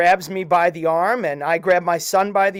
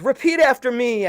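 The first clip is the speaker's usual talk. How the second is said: higher, louder call to the group; yelled command; 7 semitones higher, 4 dB louder than usual. Retold or imitated. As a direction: call it out over the rain. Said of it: "and he yells".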